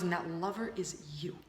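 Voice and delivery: whispered reveal, barely voiced; hushed whisper. Mentor whispering the twist to his student. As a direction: drop to a whisper for the punchline. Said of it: "and then he just stops and he goes".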